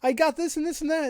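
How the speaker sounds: very high squealing voice; excited young person. Retold or imitated. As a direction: squeal like a giddy kid. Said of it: "It's like".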